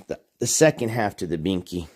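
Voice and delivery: usual speaking voice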